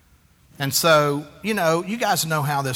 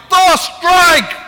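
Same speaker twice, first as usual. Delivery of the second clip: much higher, much louder, slow barked order; harsh yell; 14 semitones higher, 15 dB louder, slower than usual. Retold or imitated. Pastor shouting at the pitcher, impatient yell. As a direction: bellow it like a hot-tempered coach. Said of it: "I'm like".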